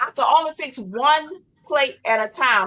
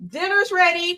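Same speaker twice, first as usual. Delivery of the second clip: yelling across the room; 5 semitones higher than usual. Pretend. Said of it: "Ringing the bell".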